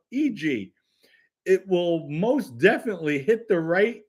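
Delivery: announcer ad read. Acted. Streamer reads a fan's donated ad message, announcer style. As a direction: sell it like a stage announcer. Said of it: "Here's German's words".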